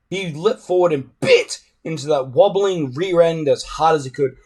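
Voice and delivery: ordinary delivery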